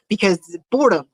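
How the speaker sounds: ordinary talk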